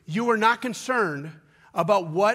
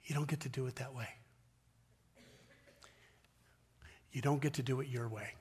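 soft whispered, much softer; grieving whisper; 6 semitones lower, 13 dB quieter, faster than usual. Acted. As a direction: breathe it out in sorrow. Jesus's grieving words whispered.

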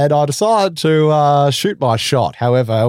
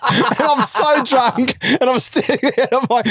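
laughing hard; 10 semitones higher than usual. Real, not acted. Telling mates about losing the girl, high laughing. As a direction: laugh so hard the words stretch.

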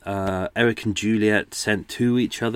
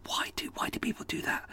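whisper; 12 dB quieter than usual. Real, not acted. Exasperated aside after mock shout.

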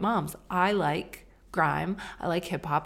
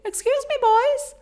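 much higher, louder, sing-song excuse me; overly polite mom; 7 dB louder than usual. Retold or imitated. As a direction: call sweetly, slow at the end, to the boys. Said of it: "It was actually so pathetic".

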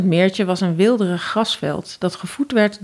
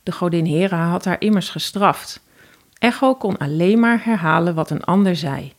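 plain reading voice

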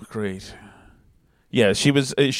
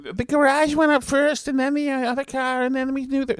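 high-pitched mock Boston accent, much higher throughout; Boston eyewitness impression; 11 semitones higher than usual. Man in the street giving an eyewitness account, mock Boston accent. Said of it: "I can't stand the man in the street or the eyewitness accounts".

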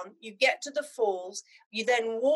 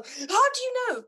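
high-pitched astonishment; amazed stranger; 12 semitones higher than usual. Retold or imitated. Stranger floored at being recognised as Zimbabwean, amazed.

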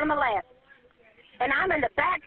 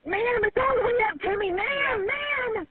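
higher-pitched, urgent wail; real panic, calling for the listener's attention; panicked calling out; 4 semitones higher, slower than usual. Real, not acted. Trapped caller cries out to dispatcher, panicked calling out.

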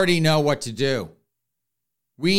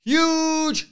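mocking emphasis; 13 semitones higher than usual. Mocking his hopes.

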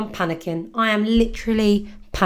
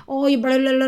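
higher-pitched mock nagging; raised, droning mock complaint; moaning nan; 6 semitones higher, 4 dB louder than usual. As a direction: imitate nan's moaning as babble. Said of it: "my nan started moaning at me".

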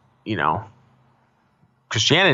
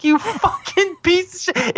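high, slow, scandalized squeal; shocked outrage; slower than usual. Retold or imitated. Mock-outraged at his spaghetti answer, high and slow. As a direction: clutch your pearls and squeal it out. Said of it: "I clutched my pearls".

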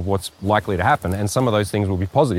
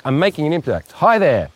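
drama teacher; 5 semitones higher than usual. Shown as a demonstration. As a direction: make an impact with it. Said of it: "it's gotta be like".

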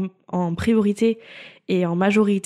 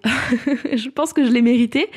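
laughing; 4 semitones higher, slower than usual. Real, not acted. Laughing realization.

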